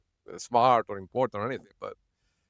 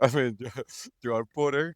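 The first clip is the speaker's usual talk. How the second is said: real laughing. Real, not acted. Vlogger amused by an absurd appointment, laughing. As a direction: talk through leftover giggles. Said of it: "I'm sorry for laughing".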